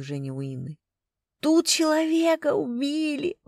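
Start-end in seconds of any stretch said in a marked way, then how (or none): 1.4-3.4 high, loud, slow wail; drawn-out hysterical cry; howling. A narrator's reading. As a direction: howl the news in horror. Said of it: "провыла она".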